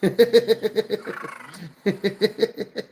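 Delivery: laughing through the words; giggling while reading it out. Real, not acted. Cracking up at a chat message.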